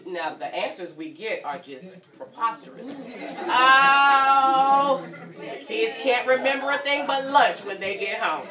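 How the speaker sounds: plain reading voice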